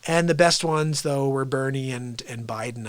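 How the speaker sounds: ordinary delivery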